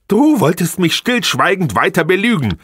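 high, overpitched shrill break; voice cracking. Acted. Wife interrupting husband, voice breaking high. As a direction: let the voice crack upward. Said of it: "mit überschlagender Stimme".

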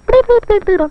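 high rapid beeps; imitated beeping. Child plays the story's noises, high imitated beeps. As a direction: make high little machine-like beeps. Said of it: "they sounded like this".

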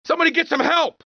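high urgent shout; calling for help. Acted. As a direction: cry out for anyone nearby. Cabbie calls for help.

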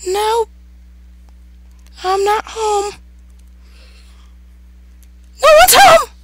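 very high falsetto taunting; mock-defiant falsetto squeak; squeaky put-on voice. Player taunting the monster locked outside, high squeaky put-on voice.

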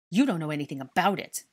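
loud, defensive retort; angry shout. A narrator's reading. Dennis rejects the scolding over his parents, shouting angrily. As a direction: throw it back at him angrily. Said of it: "Dennis shouted angrily".

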